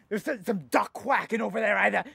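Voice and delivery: normal talking voice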